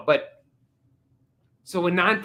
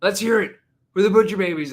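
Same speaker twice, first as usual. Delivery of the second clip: loud, high stage call for more; imitated frontman urging crowd; 9 semitones higher, 9 dB louder than usual. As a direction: push a lukewarm crowd to cheer harder. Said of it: "And then he goes".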